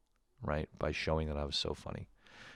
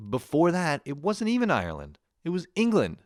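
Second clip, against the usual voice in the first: higher and louder, incredulous protest; exasperated; 11 semitones higher, 5 dB louder than usual. Real, not acted. Comedian protesting to parents about his ancestry, exasperated. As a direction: push back like you can't believe it.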